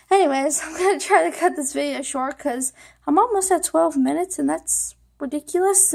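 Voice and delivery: ordinary talk, nothing marked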